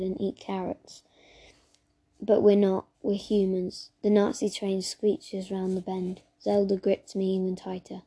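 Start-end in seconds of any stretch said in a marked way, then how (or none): none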